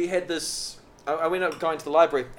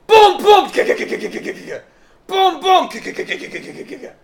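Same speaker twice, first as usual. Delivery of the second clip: loud much higher booms, then whispered ka-ka machine gun noise; mock gunfire; 13 dB louder than usual. Boy in class imitating battle, loud gunfire. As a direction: make war noises under your breath.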